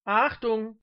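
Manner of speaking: loud stage-manager's alert; shout. A narrator's reading. Floor manager warning the audience, loud shout. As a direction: shout for attention. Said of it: "schrie der Aufnahmeleiter".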